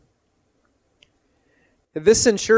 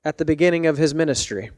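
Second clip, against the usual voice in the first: ordinary delivery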